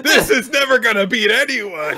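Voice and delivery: very high, loud, hammed-up screech; mock villain yell. Villain pummeled by meteors taunting his attacker, high mock yell. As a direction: shriek it in comic defiance.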